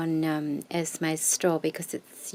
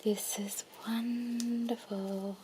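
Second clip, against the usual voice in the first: whisper=40; 11 dB quieter, much slower than usual. Real, not acted. Whispering in awe.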